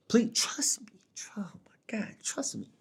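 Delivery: high, breath-only mock begging; hushed, hammed-up plea; pleading whisper. Mock begging the viewers to listen.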